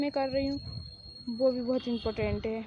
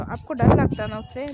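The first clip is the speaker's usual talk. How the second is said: much louder, jeering; loud taunt; 9 dB louder than usual. Acted. Son mocking his parents for fearing her, loud. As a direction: throw it at them as a jeer.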